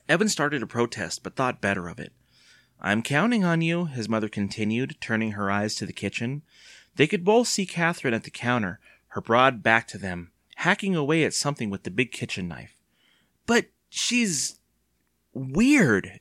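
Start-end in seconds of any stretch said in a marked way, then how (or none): 2.8-3.8 higher-pitched coaxing; earnest appeal. A narrator's reading. Mother urging her reluctant son. 13.4-16.2 high, whiny objection; protesting. A narrator's reading. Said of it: "Evan protested".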